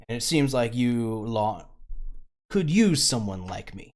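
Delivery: slippery rogue pleased with himself, only lightly put on; cocky smuggler who just broke out of his cell; smuggler NPC.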